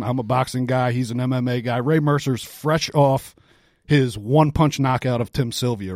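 ordinary talk, nothing marked

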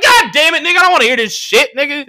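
high, loud, fed-up protest; exasperated outburst. Retold or imitated. Acting out his exasperated reaction.

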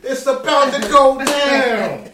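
loud high radio DJ hype; [name] hype. Pretend. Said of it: "I'll be [name]".

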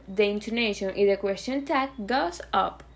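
usual speaking voice

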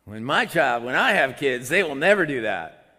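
loud, emphatic mock boast; self-assured vow. Would-be parent judging another's child, loudly self-assured. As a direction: play the parent who is so sure.

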